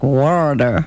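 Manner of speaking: WATER drawn out as "worr-der", low; slow water with a thick middle and an ER ending; mimicking his pronunciation. Guest mimics host's flawed version, mimicking his pronunciation. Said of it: "doing, like, a little thing in the middle".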